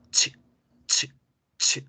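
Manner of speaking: CH as short unvoiced sneeze bursts; sonido de estornudo. Shown as a demonstration. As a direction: puff out a short CH, no voice. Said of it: "Es un estornudo". (CH corto y sordo, como estornudo)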